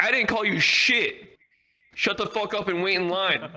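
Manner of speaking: high, exasperated imitation of an officer; arguing. Airport officer argues with passenger, exasperated retort. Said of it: "this T S A guy's arguing".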